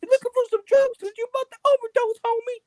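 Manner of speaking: very high, loud little gangster; Mickey Mouse. Acted. Gangster warning that the homies are coming, high mouse voice. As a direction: threaten like a squeaky cartoon gangster. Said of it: "gangster midget with a Mickey Mouse voice".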